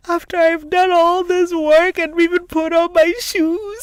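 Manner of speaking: high, loud, slow mock wailing; imitated crying child. Mother imitating her son's protest at staying home, mock wailing. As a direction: wail like a child in tears, hammed up. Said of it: "He burst into tears".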